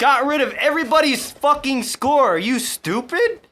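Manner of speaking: high, loud imitation of a berating sergeant; in-your-face sergeant. Sergeants get in a trainee's face, mock angry yelling. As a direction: chew out a trainee in mock fury. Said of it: "getting in my face".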